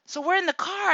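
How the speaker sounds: ordinary talk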